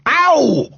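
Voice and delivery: loud, high cry of pain; yelped groan at a stubbed toe. A narrator's reading. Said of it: "he groaned".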